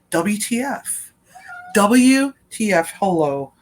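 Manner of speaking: exaggerated what-on-earth reaction; mock disbelief. Retold reaction to sudden blackout.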